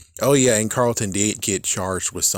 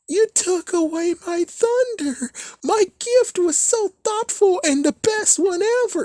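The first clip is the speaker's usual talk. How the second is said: much higher, whiny mimicry; mocking whiny voice. Vlogger mocking Vi's birthday tantrum, high whiny voice. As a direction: parody her as a petulant whiner. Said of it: "all of a sudden".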